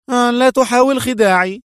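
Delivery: high, loud cross warning; indignant. A narrator's reading. Woman warning her partner off his excuses, high and indignant.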